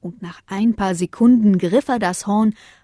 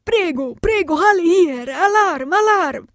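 much higher urgent call; rally everyone; calling alarm; 11 semitones higher, 4 dB louder than usual. Acted. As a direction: Antonio rallying the dogs. Antonio rallies the dogs.

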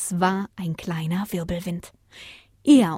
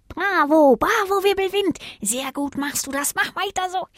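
hohe, begeisterte Strauchstimme; 1 lobender Brombeerstrauch; 10 semitones higher than usual. A narrator's reading. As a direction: feuer den Wirbelwind schadenfroh an. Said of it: "lobte der Brombeerstrauch". (hohe, jubelnde Strauchstimme)

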